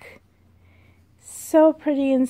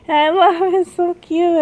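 "I love it" high and loud; excited gush; 6 semitones higher, 6 dB louder than usual. Real, not acted. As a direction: squeal over the little jean jacket. Gushing over jean jacket.

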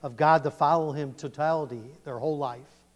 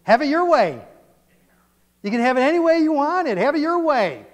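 loud, high advertising jingle voice; Burger King slogan; 10 semitones higher, 7 dB louder than usual. Retold or imitated. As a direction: belt it out like a fast-food ad. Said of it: "What's their philosophy?".

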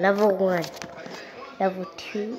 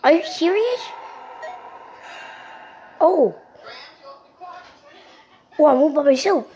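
shocked outcry; 8 semitones higher than usual. Real, not acted. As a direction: cry out in dismay, alone. Game upset, alone.

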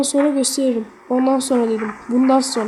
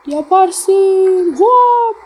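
excited cheer; 7 semitones higher, 7 dB louder, much slower than usual. Real, not acted. Cheering character on.

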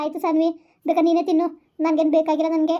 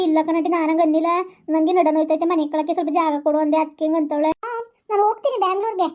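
ordinary delivery

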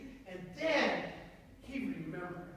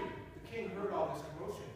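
ordinary delivery

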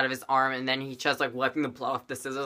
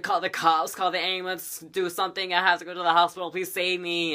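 higher-pitched frantic cry for help; panicked plea; 5 semitones higher than usual. Acted. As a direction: beg for help in a mock panic.

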